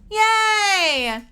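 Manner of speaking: high, loud victory whoop; excited cheer. Real, not acted. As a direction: celebrate like a win. Celebrating the squeak.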